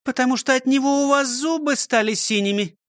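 proud, high-pitched teacher; high voice, mildly pleased with herself; proudly explaining. A narrator's reading. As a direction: explain with a touch of pride. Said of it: "с гордостью объяснила Фрёкинсталь".

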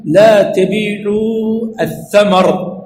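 "لا تبيعوا الثمر" drawn out slowly, then stop dead; slow, cut-off quote. Shown as a demonstration. Teacher demonstrating a truncated hadith, slow. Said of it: "ويسكت".

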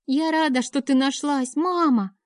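put-on high happy child's voice; a small girl glad to have her mother back; little girl. A narrator's reading. Daughter hugs her mother, high tender child voice.

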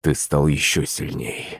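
low, soft, slow approval; quietly pleased. A narrator's reading. Prince to his altered beast, quietly pleased. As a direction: savour it slowly in a hushed low voice. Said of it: "довольно ответил Шувалов".

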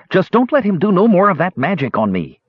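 high, pleading rough-edged voice; pleading thief. A narrator's reading. Terrified thief pleads with the mage. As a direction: beg them to stop the magic.

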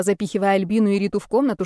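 usual speaking voice

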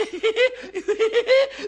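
high, loud giggle; giggling kobold laugh. Acted. Pumuckl giggles at his own prank, high giggle. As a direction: burst into cheeky giggles.

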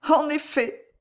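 high, slow, distressed assent; faltering stammer. A narrator's reading. Alice feigns agreement at the marriage news, faltering stammer. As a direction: falter weakly through the words. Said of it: "balbutia Alice".

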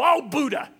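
high, loud exasperated cry of Oh, Buddha; mock exclamation. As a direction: fake a frustrated oath. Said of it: "hear anyone go".

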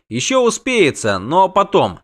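high, grumbling protest; indignant halfling. A narrator's reading. Halfling refuses to trust local authorities, indignant. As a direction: argue it hotly, pitched high.

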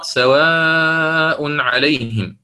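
سواء عليهم with the long vowel of سواء stretched far out in ishba; سواء عليهم drawn slowly with the madd of سواء held long; elongated madd. Shown as a demonstration. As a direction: hold the long alif in سواء عليهم for a full ishba length. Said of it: "بالإشباع".

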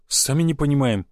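ordinary delivery, nothing marked

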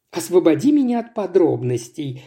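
plain reading voice

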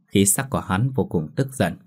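ordinary delivery, nothing marked

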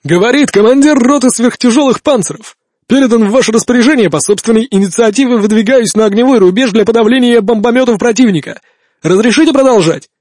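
brisk announcer-like radio report; cheerful and crisp, like a radio announcer; over walkie talkie. Acted. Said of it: "бодрый, почти дикторский голос".

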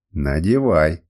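low, firm order; stern repeated command. A narrator's reading. Captor repeats his order to the raging woman, stern command. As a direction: insist in a low, controlled voice. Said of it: "повторил я".

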